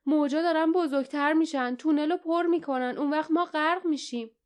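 high, understated panicked cry; alarmed cry. A narrator's reading. Jasmine fears drowning in the filling tunnel, high alarmed cry. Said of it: "جاسمین فریاد زد".

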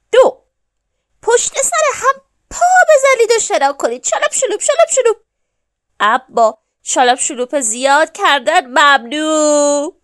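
loud, high, energetic rule-giving; animated duck teacher at full volume; duck instructor. A narrator's reading. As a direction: call out the steps like a coach. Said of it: "اردک گفت".

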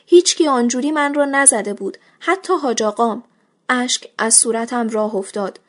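ordinary delivery, nothing marked

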